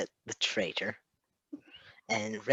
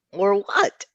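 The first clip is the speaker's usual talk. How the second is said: higher, louder careless challenge; unconcerned; 9 semitones higher, 9 dB louder than usual. A narrator's reading. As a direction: call the bluff lightly. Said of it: "Remy asked, unconcerned".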